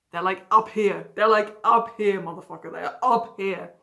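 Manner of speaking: loud punch on "up here"; emphatic UP HERE. Real, not acted. Explaining how they idolise someone, emphatic stress on "up here".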